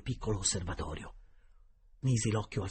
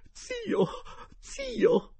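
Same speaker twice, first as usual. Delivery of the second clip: much higher, loud shrieking cry; exclaiming lady; 5 dB louder than usual. A narrator's reading. Geltrude crying out to her uncle's spirit, high loud shriek. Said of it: "esclamò la signora Geltrude".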